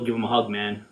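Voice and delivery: normal talking voice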